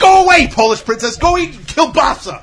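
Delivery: playful shout at a friend; mock insult. Teasing his Polish friend with a loud mock jab. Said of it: "teasing friend".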